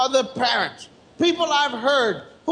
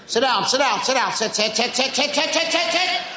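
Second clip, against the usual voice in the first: tobacco auctioneer. Acted. Parent ordering child to sit, auctioneer patter. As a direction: rattle it off like an auctioneer, climbing in pitch. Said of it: "Sound like a tobacco auctioneer".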